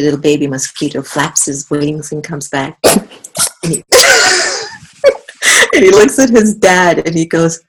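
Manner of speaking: normal talking voice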